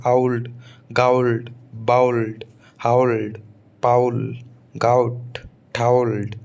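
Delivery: ओल्ड, गाउल्ड said with an ow glide; teacher drilling the English ow sound; British ow drill. Shown as a demonstration. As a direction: drill each word with owld. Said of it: "ये अंग्रेज बोलते हैं".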